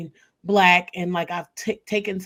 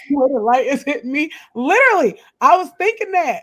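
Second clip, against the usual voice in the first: laughing insistence; 6 semitones higher, 8 dB louder than usual. Real, not acted. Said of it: "Diabetes by the neck?".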